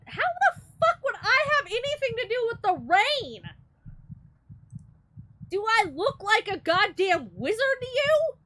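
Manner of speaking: high, loud incredulous rant; indignant outburst. Real, not acted. Player defending against blame for the rain, loud and indignant. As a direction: fire back, offended and laughing.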